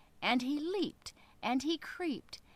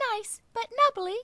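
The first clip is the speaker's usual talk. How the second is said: high, louder squeaky fish voice; little fish voice; 12 semitones higher, 7 dB louder than usual. Acted. Little fish flatters the whale, high and piping. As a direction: pipe up like a tiny clever fish.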